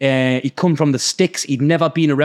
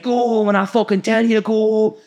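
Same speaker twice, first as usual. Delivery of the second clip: higher-pitched mock rant; retold raving, raised in pitch but not shouted; imitating someone losing it; 5 semitones higher than usual. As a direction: act out a man losing his mind. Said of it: "He was just losing his mind".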